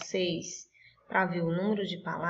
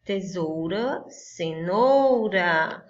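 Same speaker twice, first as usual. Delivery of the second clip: Tesoura, cenoura drawn out slowly, cenoura longest; slow stretched; 2 semitones lower, much slower than usual. Shown as a demonstration. Teacher letting the kids hear the rhyme, slow and drawn out. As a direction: linger slowly on the rhyming endings. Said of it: "Tá correto?".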